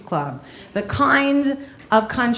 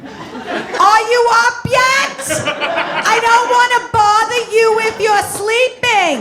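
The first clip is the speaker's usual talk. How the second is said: whisper scream; 11 semitones higher, 6 dB louder than usual. Retold or imitated. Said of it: "my mother has this whisper scream".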